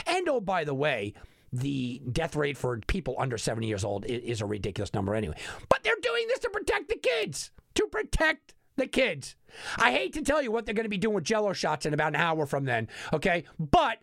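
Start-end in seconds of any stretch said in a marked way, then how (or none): 5.7-9.3 high, mocking parody of an official excuse; sarcastic mock. Host mocking the conference's reason, sarcastic high parody. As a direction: sneer it out like a pious press release.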